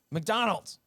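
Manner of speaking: high loud blurt; yell. Retold or imitated. Brother yelling in the theater, loud high blurt. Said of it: "he would just yell and be like".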